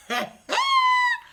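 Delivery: high-pitched cackle; high laugh. Real, not acted. Laugh heard.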